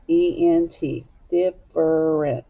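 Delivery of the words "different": "Different" slowly in three parts, diff-er-ent; every syllable sounded. Shown as a demonstration. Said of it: "we don't always say different".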